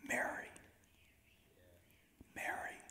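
soft, near-whispered call of a name; gently hushed, tender calling by name. Retold or imitated. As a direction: say the name very softly, lingering in the quiet. Jesus gently calls her name.

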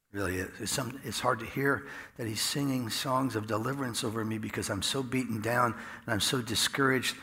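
ordinary talk

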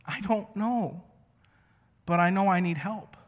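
high imitated toddler voice; little boy. Three-year-old to dad needing help, pleading kid voice. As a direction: plead like a helpless small child.

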